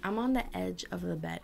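ordinary talk

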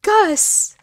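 high, loud melodramatic wail; mock heartbroken cry. Player dropped by boss Gus, mock heartbroken cry. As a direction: cry out as if dumped.

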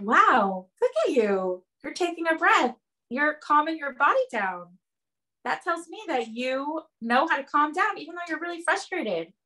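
high encouraging praise; praising child. Retold or imitated. Mother praising her screaming daughter, high and encouraging. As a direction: cheer her on, gently amazed. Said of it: "And I said".

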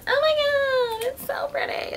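squealing gush. Real, not acted. Gushing to viewers over the pretty nude swatch, squealing then whispering. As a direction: swoon over the color, voice squeaking up then breathy.

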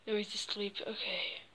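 soft whisper so he won't wake. Acted. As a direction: hush it near a sleeper. Friend sleeping nearby.

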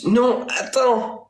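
high, slow drawn-out "No, attends!"; mock panicked French. Shown as a demonstration. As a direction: stretch the French plea up high. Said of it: "In French".

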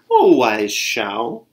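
slow, loud, drawn-out call; courteous, stretched-out appeal; polite address. A narrator's reading. Bird asks wise Xiao a question, politely and slowly. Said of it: "Bird asked politely".

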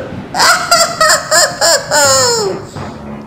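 high evil cackle; witch cackle. A narrator's reading. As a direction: cackle like a hag. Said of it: "cackled the witch".

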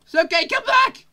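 high, loud plea; calling out. Pretend. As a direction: call after someone leaving. Calls after departing game figure.

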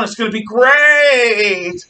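slow, loud, drawn-out cheer; gleeful hope. Retold or imitated. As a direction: crow it out like the win is certain. Anti-Nazi officers' hopes voiced.

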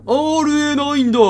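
high, loud excited cry of the name; excited shout. Acted. Gamer shouting the code name at the screen, high and loud. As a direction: call it out, startled and high. Game character line voiced loudly.

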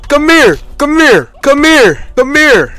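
high, loud come here; real adrenaline chase yelling; excited hunting shout. Real, not acted. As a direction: call him out as you chase. Chasing an enemy player.

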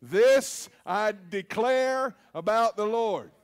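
slow, emphatic, each word weighted; slow declaration. Shown as a demonstration. Pastor leading the congregation in declaring the verse, slow and emphatic. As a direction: lead the room in a bold confession. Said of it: "Say it with me".

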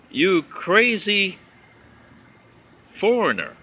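each word drawn out very slowly, mocking the insult; slow mocking read. Reading a letter-writer's insult aloud, drawn out slowly. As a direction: read it out slow, like holding the insult up for all to see.

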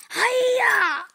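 high, loud karate yell; battle cry. Pretend. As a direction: scream it as you strike. Strikes the shark.

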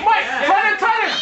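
high, insistent corner call; urgent coaching call. Real, not acted. Coach telling Mike to turn him, urgent call. Cornerman calls during bout.

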